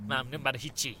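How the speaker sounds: very low, quiet grumble; sullen thanks. Acted. Boy thanking the guide for nothing, sullen grumble. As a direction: drop it low and soft, unimpressed. Sour thanks after getting no help.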